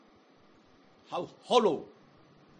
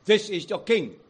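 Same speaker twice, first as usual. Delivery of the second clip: proclaiming; 3 semitones higher, 8 dB louder than usual. Acted. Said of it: "we will hear the question".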